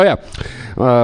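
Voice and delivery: ordinary delivery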